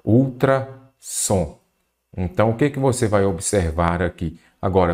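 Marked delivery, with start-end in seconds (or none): 0.0-1.6 Ultrassom stretched out very slowly; teacher slowly recalling the old spelling Ultrassom; very slow example word. Shown as a demonstration. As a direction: draw out Ultrassom syllable by syllable. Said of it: "Antes a gente escrevia o quê?".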